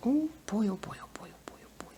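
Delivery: fading whispered chant; whispering. Acted. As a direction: let it fade to a whisper.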